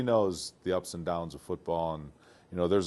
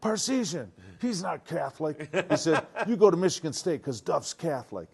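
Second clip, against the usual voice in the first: much higher, insistent father voice; upset dad; 8 semitones higher than usual. Retold or imitated. The father ordering his son to Michigan State, indignant. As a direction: lay down the law like an indignant dad. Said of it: "Then he really got upset".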